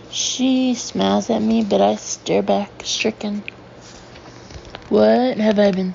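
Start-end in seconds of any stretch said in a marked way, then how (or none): none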